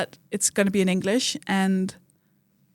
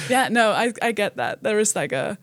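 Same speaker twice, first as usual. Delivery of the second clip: usual speaking voice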